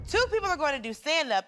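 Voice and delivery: ordinary talk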